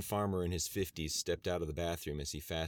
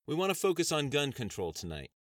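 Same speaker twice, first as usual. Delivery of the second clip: higher, louder bright host welcome; brassy talk show host opening; TV host proclaiming; 8 semitones higher, 5 dB louder than usual. Acted. Host speaks.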